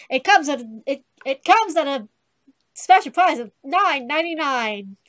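loud mock advert pitch; salesperson parody. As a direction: belt it out like a shopping-channel seller.

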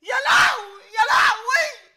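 loud comic wail of despair; wailing cry. Acted. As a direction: throw up your hands and wail. Wailing woman in staged scene.

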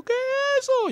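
sister's high alarmed cry at the rifle; frightened sister. Retold or imitated. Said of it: "se asustó y me dijo".

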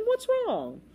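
high, breezy smiling question. A narrator's reading. Carefree captain questions the boy, smiling. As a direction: ask it without a care. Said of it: "smiled the captain".

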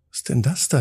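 usual speaking voice

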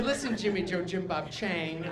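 a touch of tough guy; mock stern. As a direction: lean in quietly to set him straight. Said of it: "I said".

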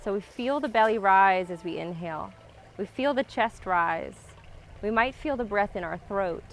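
calm grounding exercise. Acted. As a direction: guide the breath, calm and unhurried. Guides a slow breathing practice.

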